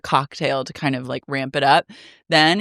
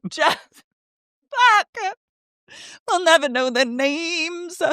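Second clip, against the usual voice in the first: high laughing groan; 11 semitones higher than usual. Real, not acted. Frustrated at names.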